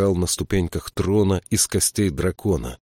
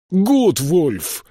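high, loud cry of a name; calling out; 11 semitones higher, 5 dB louder than usual. A narrator's reading. King calling after the count, high ringing shout. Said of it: "крикнул ему вслед Элиас".

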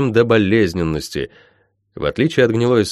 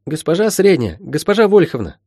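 put-on higher, lightly girlish call; eager girl calling; 8 semitones higher than usual. Molly calling to the healer, eager. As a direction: call for the teacher's attention. Molly calls for her teacher's attention.

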